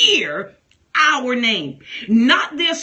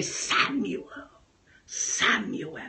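much softer, low and hushed; intimate soft call; 4 semitones lower, 8 dB quieter, much slower than usual. Retold or imitated. Preacher retells God calling his name, soft and hushed. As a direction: say the name tenderly, almost to himself. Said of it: "I heard God say".

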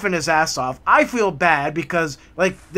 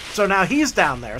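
normal talking voice

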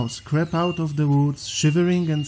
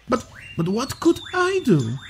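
higher, hesitant camel voice; timid camel voice; 8 semitones higher than usual. Acted. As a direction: stammer it up high. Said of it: "the camel wanted to know".

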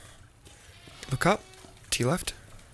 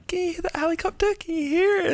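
very high-pitched squeaky imitation; hammed-up squeal; excited mock quote; faster than usual. Mimics a line from the game.

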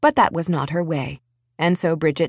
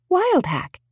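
coy; 12 semitones higher than usual. A narrator's reading. Bridget savours the name.

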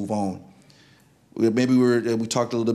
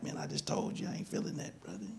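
muttered reply; 4 semitones lower, 16 dB quieter than usual. Retold or imitated. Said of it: "I'm like".

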